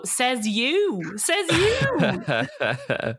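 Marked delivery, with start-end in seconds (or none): 1.2-3.1 laughing scoff. Retold or imitated. Host scoffing back at a housemate, laughing.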